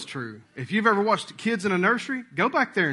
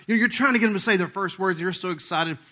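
normal talking voice

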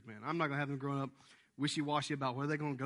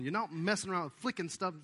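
usual speaking voice

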